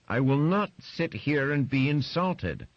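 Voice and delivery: high, loud, slow indignant protest; offended wife. Acted. Wife refusing to be insulted by husband, high, slow and loud. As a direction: draw yourself up, deeply insulted.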